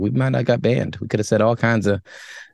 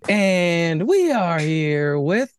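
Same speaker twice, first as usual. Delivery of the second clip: slow, higher stretched intro with a rising end; drawn-out show intro; 6 semitones higher, much slower than usual. Acted. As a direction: draw it out for the next guest. Show intro hosts sequence.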